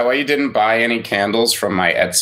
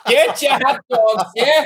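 1920s boardwalk vendor; 14 semitones higher than usual. Pretend. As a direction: bark it like a hot dog seller on a 1920s boardwalk. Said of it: "Atlantic City Boardwalk circa nineteen twenty-two".

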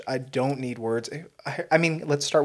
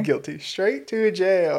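higher, drawn-out joke sentencing; mock verdict; 6 semitones higher, 5 dB louder, much slower than usual. Joking about the celebrity's self-incrimination, high mock verdict. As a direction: pronounce a playful guilty verdict, stretching the words. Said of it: "You're like".

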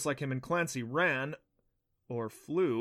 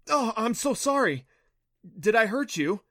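high, loud, anxious apology; worried apology; 10 semitones higher, 8 dB louder than usual. A narrator's reading. Boy worried he hurt the naiad, anxious and high. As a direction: blurt it out, afraid he hurt her.

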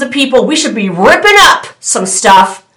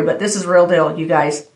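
confiding aside; 6 semitones lower, 8 dB quieter than usual. Real, not acted.

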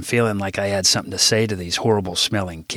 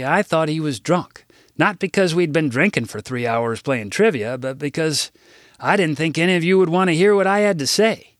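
higher-pitched addressing an assembly; formal speech; 7 semitones higher than usual. Acted. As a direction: speak up to a hall of kids, a touch showy. Gives a school assembly speech.

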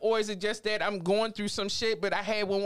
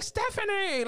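high, mocking call of the wrong name; imitating the Nigerians; 12 semitones higher, slower than usual. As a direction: toss out Stephanie like a teasing aunt. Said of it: "They'd be like".

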